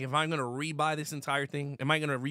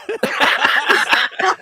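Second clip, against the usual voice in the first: loud, high, breathy laugh; burst of laughter; 13 semitones higher, 13 dB louder than usual. Real, not acted. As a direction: let out a big laugh. Pasta joke cracks him up.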